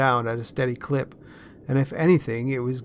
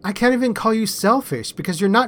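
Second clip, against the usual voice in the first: mock indignation, higher and a little louder; faux outrage; 7 semitones higher, 5 dB louder than usual. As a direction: pretend to be scandalised, playfully. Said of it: "with faux outrage".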